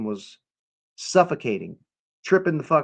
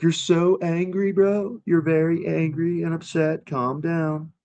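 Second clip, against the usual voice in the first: mock-concerned higher drawn-out voice; mocking whiny quote; 5 semitones higher, slower than usual. Streamer mocks chat users telling him to calm down, in a higher, slowed mocking tone. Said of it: "people coming in like".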